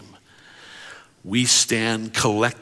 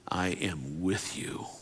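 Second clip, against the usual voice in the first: soft, slow near whisper; hushed and slow; 2 semitones lower, 7 dB quieter, slower than usual. Acted. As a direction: linger on each word gently. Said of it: "whisper over top".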